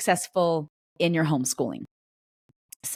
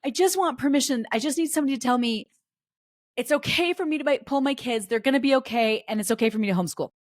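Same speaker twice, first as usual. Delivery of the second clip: higher, pleading little voice; lightly voiced nervous parent begging; imitating anxious parent; 6 semitones higher than usual. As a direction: play the worried parent asking for a yes. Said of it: "for those of you that are like".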